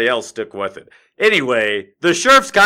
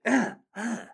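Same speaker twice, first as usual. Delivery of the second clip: mock fighting grunts; 8 semitones higher, 8 dB quieter than usual. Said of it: "he's like".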